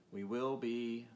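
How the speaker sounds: ordinary delivery, nothing marked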